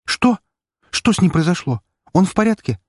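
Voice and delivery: sharp high frightened questions; panicked rush of worry. Acted. Shocked and frightened about Roman. (испуганно, высоко)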